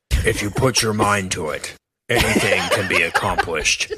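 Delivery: lightly imitated deep heroic robot; a hint of noble Optimus Prime; Optimus Prime impression. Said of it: "hear Optimus Prime at the end".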